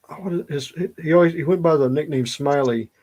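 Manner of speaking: ordinary talk, nothing marked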